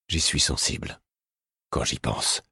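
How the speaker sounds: low, soft and grave; a grave, quiet king. A narrator's reading. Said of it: "fit-il d'un ton grave".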